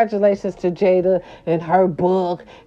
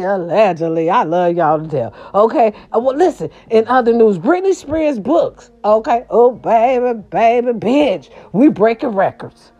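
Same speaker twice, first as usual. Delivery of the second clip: ordinary delivery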